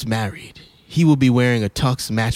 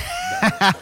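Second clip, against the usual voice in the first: high laugh; 10 semitones higher than usual. Real, not acted. Host laughs at refusal.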